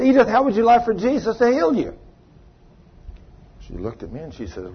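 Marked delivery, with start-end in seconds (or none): 0.0-1.9 high, eager invitation; eager offer. Retold or imitated. Preacher offering healing to Edith, eager and high.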